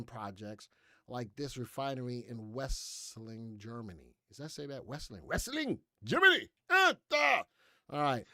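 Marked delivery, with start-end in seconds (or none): 5.3-7.4 put-on loud-edged, high-pitched comic German accent; mock German accent. Host clowning with a German town name, mock German accent. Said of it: "That was my German accent".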